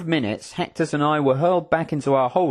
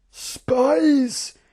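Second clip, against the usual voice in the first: high, drawn-out accusing exclamation; contemptuous cry; 12 semitones higher, 3 dB louder than usual. Acted. General seizing the eavesdroppers, contemptuous cry. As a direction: fling the accusation out with disgust. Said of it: "he cried in a tone of contempt".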